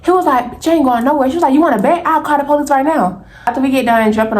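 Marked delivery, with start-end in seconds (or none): 0.5-1.3 high-pitched retold defiance; defiant refusal. Acted quarrel, man refusing. 1.5-3.2 high-pitched retold threat; heated threat. Acted quarrel, friend threatening police.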